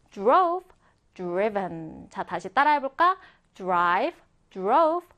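ordinary delivery

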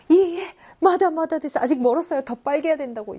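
high, loud, over-the-top protest; flustered modest denial. Acted. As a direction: act out brushing off a compliment far too eagerly.

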